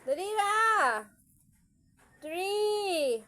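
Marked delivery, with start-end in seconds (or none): 2.2-3.2 loud counting. Real, not acted. Loud counting game with baby.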